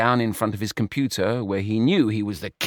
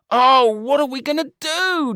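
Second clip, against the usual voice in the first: wailing; 5 dB louder than usual. A narrator's reading. George despairing to Brian, wailing. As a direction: stretch the cry out helplessly. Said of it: "George wailed".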